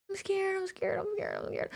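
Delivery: high, soft repeated squeaks; tiny frightened high-pitched chant; scared squeaks. Real, not acted.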